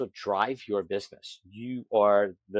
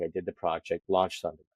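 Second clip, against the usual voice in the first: ordinary talk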